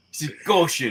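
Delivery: high, hammed-up Cockney; mock sexy Cockney. Painter jokes about runny paint, mock Cockney. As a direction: squeal it in put-on Cockney. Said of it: "sexy talk from a Cockney".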